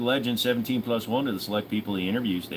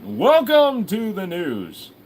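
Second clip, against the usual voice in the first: mock news anchor; 6 semitones higher, 3 dB louder, much slower than usual. Mock newscaster opening.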